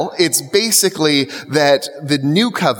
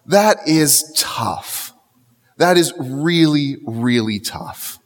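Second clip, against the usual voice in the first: slow sober weight; slower than usual. Real, not acted. Preacher pausing after the rebuke, slow and sober. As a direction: let the weight sink in. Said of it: "slow heavy reflection".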